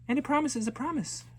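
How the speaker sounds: put-on high, quick childlike vow; little girl promising. Girl repeats the title phrase.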